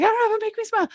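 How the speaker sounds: squeaky high mock thought; a high little voice, mocking herself; squeaky quote. Retold inner thought, squeaky.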